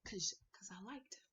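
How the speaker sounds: a sheepish whispered confession; whispered 'cause I liked him. Real, not acted. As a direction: breathe it out like a secret. Confessing a date secret.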